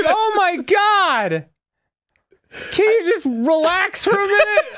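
high, exasperated cry; exasperated shouting. Real, not acted. Player begging partner to relax, exasperated.

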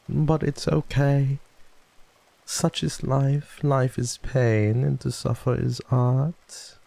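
high, drawn-out musing; slow theatrical character voice. Acted. Streamer consoles the sicklings about pain, slow theatrical voice. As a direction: savour each word slowly, theatrically.

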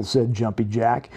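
ordinary delivery

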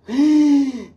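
high, loud delighted gasp; excited gasp. Real, not acted. Delighted gasp on seeing a viewer's comment. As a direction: gasp with joy at a viewer's name.

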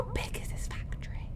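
hushed unvoiced whisper. Real, not acted. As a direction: breathe it out in awe. Awed question in factory.

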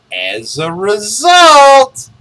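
slow, high, loud build; dramatic pause. Shown as a demonstration. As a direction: drag it out dramatically. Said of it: "Here, the dramatic pause".

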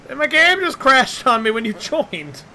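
high, loud wail of dismay; upset, high-pitched gamer whine; distressed complaint. Real, not acted. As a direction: wail about the crash. Game crashes, complains.

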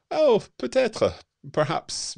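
high, loud, drawn-out oh peut-être; a surprised, playful perhaps; surprised maybe. Real, not acted. Host answering a viewer's meetup idea, surprised and playful.

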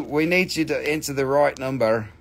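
usual speaking voice

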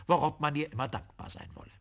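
ordinary delivery, nothing marked

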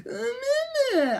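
high-pitched mock whining noise; whiny whine. Reviewer mocking how whiny Shinji seems, whining. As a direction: whine wordlessly, high and drawn. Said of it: "less whiny and less".

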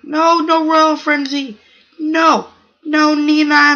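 loud, high frantic no's; frantic shout. Real, not acted. Gamer yelling at rival cells eating him, frantic shout. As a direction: yell at the game as you get eaten.

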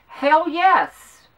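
loud, high hell yes, fully behind it; emphatic outburst. Real, not acted. Answering the man's fear of takeover, a defiant loud yes. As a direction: throw it out with real conviction.

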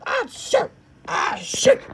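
high yelp, trailing into a breathy cry; pained cry. Real, not acted. Caught by the scare, crying out high and pained. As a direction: cry it out as if hurt. Cursing in pain or fright.

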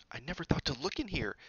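high, hushed excitement; eager stage whisper; excited whisper. A narrator's reading. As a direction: whisper it in a thrilled rush. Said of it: "she whispered excitedly".

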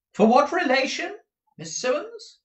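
very high, loud prying question; odd, audible voice. A narrator's reading. Miss Nagg asks who is being mourned, high and audible. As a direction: pipe it up loud for all to hear. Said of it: "asked Miss Nagg in an odd, audible voice".

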